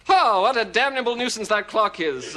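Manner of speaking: hammed-up vexed outburst; mock exasperation. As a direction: complain theatrically about the interruption. Host cut off by time.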